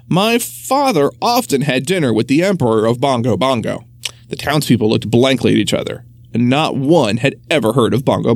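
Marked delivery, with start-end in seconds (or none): none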